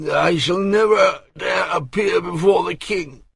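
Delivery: a hammed-up groaning lament; whining despairing old courtier. A narrator's reading. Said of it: "he groaned".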